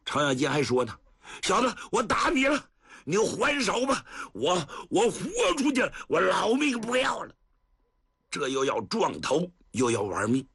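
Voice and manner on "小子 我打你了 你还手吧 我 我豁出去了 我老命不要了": high, rushed desperate rage; daring him to hit back; reckless fury. Acted. Old man risks his life angrily. (豁出老命的怒吼)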